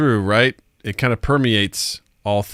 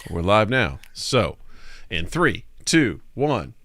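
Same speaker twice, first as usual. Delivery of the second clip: normal talking voice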